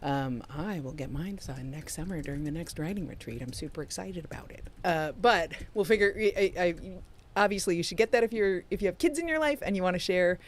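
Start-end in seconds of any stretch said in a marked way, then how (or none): none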